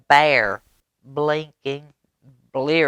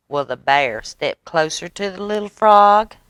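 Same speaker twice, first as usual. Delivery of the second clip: usual speaking voice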